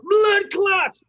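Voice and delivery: high, loud Jamaican-style yell; shouted curse. Acted. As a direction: yell it out, pitch way up. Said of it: "Finally, I can yell out".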